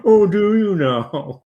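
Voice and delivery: loud, high, drawn-out mock amazement; sarcastic. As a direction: stretch it out, dripping with sarcasm. Mocking a smug parent's boast.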